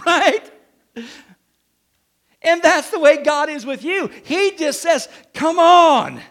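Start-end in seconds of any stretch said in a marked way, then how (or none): 2.4-6.2 higher and louder, shouting praise; fervent shouted sermon; preaching fervor. Real, not acted. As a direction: preach it out at full force. Preacher at full force.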